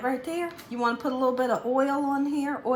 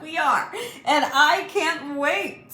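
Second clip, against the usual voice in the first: laughing; 6 semitones higher, 5 dB louder, slower than usual. Real, not acted. Cooking-vlog host laughs about menu.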